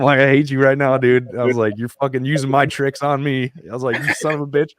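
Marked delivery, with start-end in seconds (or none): 0.2-3.5 higher and louder, laughing through tears; playfully furious at being out-tricked; mock angry outburst. Friend used his own tricks, mock yelling at him. 4.0-4.7 higher, half-laughing curse; affectionate mock fury; mock angry curse. Moved veteran cursing his friend in mock anger.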